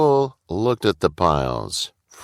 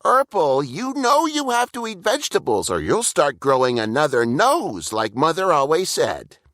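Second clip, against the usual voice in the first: high, fast scolding; high, fast chiding Burple; chiding little brother; 8 semitones higher than usual. A narrator's reading. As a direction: nag your big brother like your mother would. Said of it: "Burple chided".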